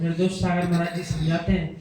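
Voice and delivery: ordinary talk, nothing marked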